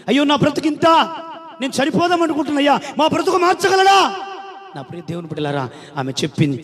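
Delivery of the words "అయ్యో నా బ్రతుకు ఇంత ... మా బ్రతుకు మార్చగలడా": desperate plea. Acted. Poor woman pleads in story.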